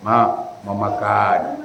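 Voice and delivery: slow, soft and tender, vowels stretched; gentle drawn-out request. Shown as a demonstration. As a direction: ask your wife sweetly and slowly.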